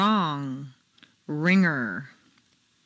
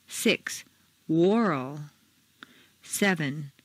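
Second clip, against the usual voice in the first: usual speaking voice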